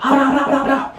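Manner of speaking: high-pitched, rapid blah-blah; mock cussing gibberish. Storyteller mimics cussing he couldn't understand, high mock babble. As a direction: imitate unintelligible cursing way up high. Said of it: "I don't know what he's saying".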